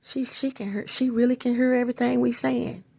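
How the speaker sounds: soft, hushed and calm; quietly matter-of-fact; calm murmur. Retold or imitated. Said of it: "they'll just calmly say".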